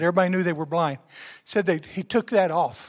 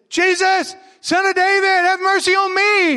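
high, loud desperate shout; crying out; 12 semitones higher, 7 dB louder than usual. Acted. A blind beggar shouting to Jesus, loud desperate cry. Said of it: "he cried out all the more".

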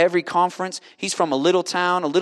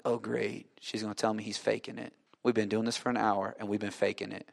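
low, soft inner grumble; hushed, sinking aside; dismayed muttering; 6 semitones lower, 12 dB quieter than usual. Retold or imitated. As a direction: mutter your dread to yourself. Retold private dread at sister's news.

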